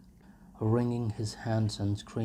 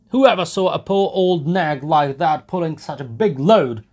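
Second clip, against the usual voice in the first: loud, high scoffing call; mock the driver from the crowd; jeering onlooker; 7 semitones higher, 13 dB louder than usual. A narrator's reading. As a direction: bystander scoffing at the overloaded cart. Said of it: "added another".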